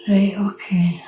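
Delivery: whispered aside. Real, not acted. Muttering to herself mid-live.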